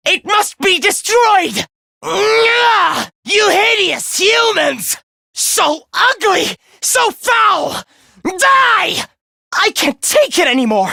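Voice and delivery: high, drawn-out shouts of loathing; enraged disgust. Acted. Villain cursing hideous humans, high enraged shouting. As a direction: cry out in revulsion, pitch soaring.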